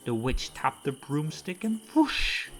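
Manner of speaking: ordinary delivery, nothing marked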